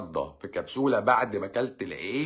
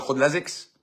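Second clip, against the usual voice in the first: a mock-incredulous «خد لازكس» with the voice rising on «لازكس»; mocking quote; 5 semitones higher, 4 dB louder than usual. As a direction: quote the advice as if it were absurd, ending in a question. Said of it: "مش عارف اقول لك". (اقتباس ساخر بنبرة استنكار)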